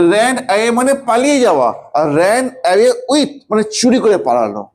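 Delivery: usual speaking voice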